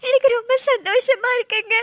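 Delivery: tearful, joyful wife; choked up with joy; happy crying. Acted. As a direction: say it through happy sobs. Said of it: "இந்த நேரத்துல நீ அழக்கூடாது".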